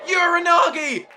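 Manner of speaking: shouting the move name as it lands; excited shout. Real, not acted. Commentator to viewers, shouting the move name. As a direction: yell it out over the crowd. Commentator calls a big slam.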